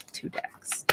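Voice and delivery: whispered aside, voice gone to breath; real whisper. Real, not acted. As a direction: breathe it out as a whisper after knocking over the cards. Aside after knocking over decks.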